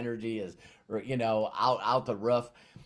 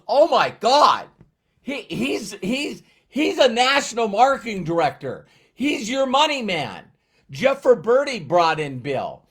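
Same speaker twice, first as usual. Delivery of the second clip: much higher and louder, slow and astonished; thrilled exclaiming; 11 semitones higher, 11 dB louder than usual. Retold or imitated. The mentor telling him Bill is his money man, loud thrilled gushing. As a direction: gush it like the best news ever. Guy thrilled at finding the upline.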